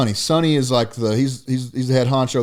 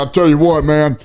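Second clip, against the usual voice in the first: much higher, louder, hyped-up coach growl; Dan Campbell impression; 8 semitones higher, 5 dB louder than usual. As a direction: pump up the room like a fired-up football coach. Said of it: "And he's just sitting there".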